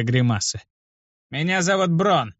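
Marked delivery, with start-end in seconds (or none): 1.3-2.3 gritted. A narrator's reading. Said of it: "процедил он".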